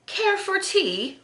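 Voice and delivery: high, slow mock-English accent; hammed-up English tea invitation, pitched high; bad English accent. Vlogger playfully offers tea from the Mad Hatter line, bad English accent.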